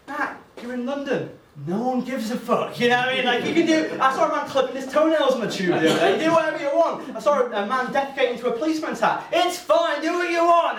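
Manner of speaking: brisk mock reassurance, laughing through it; animated friend. Friend on the phone cheering Matt up, animated and loud. Friend's pep talk, voiced.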